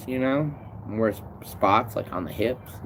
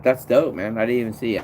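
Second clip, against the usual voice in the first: ordinary talk, nothing marked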